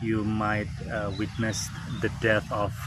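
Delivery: normal talking voice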